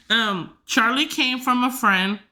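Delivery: normal talking voice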